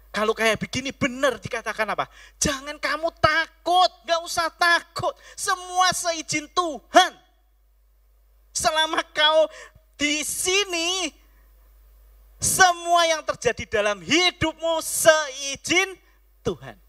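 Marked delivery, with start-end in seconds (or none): 2.4-7.1 high, loud reassurance; God's comfort proclaimed, higher and louder; fervent preaching. Acted. Quoting God's reassurance in sermon. 8.5-11.1 high, loud, slow declaration; drawn-out sermon proclamation; forceful preaching. Acted. Preacher declaring God's protection. 12.4-16.8 loud, high, slow shout; full-force sermon shout; preaching shout. Real, not acted. Preacher shouting over music.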